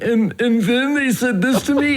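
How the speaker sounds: high-pitched, hammed-up patient voice; complaining therapy patient. Patient venting to counselor on the couch, whiny.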